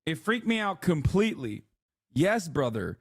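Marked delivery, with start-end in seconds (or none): none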